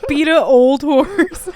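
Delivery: slow, high, amused repeat; mock the phrase while laughing. Real, not acted. Mocking the mixed idiom.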